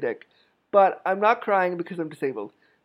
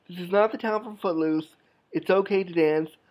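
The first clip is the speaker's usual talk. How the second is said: usual speaking voice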